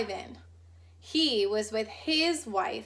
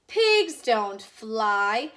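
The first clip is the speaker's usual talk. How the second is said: very slow and louder, flat dismissal; stern scolding; 6 dB louder, much slower than usual. Acted. Grouchy sister correcting the Watsons, slow and stern. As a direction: spell it out to a fool.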